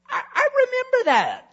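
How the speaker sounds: high, fast, eager king voice; excited king. Acted. King remembering his rescuer, high and quick. Xerxes recalls the plot.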